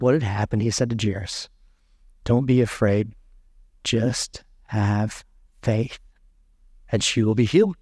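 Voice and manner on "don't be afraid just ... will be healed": gentle reassurance. Acted. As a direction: draw out have faith tenderly. Jesus comforting grieving father.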